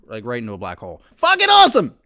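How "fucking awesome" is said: loud, very high triumphant cry; ecstatic shout. Real, not acted. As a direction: yell your verdict out. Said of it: "my official stance".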